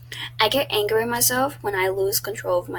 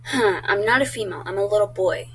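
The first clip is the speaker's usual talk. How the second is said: ordinary delivery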